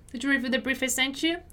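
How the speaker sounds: fast, linked-up question; conversational-speed model with a rising end. Shown as a demonstration. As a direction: ask it briskly at natural speed. Teacher repeats at quicker pace.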